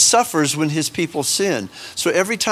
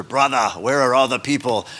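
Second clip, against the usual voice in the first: lower-pitched Australian missionary impression; George Francis's Australian accent; 4 semitones lower than usual. Visiting missionary to the pastor, imitated Australian accent. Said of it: "Brother George Francis is like to the pastor".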